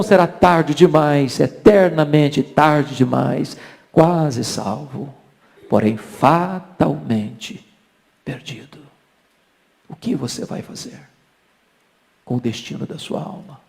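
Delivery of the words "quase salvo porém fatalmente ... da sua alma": hushed, weighty question to the congregation; solemn appeal. Real, not acted. Solemn appeal after the climax. (apelo solene, pausado e baixinho)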